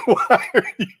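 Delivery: laughing. Real, not acted. Laughing at clip.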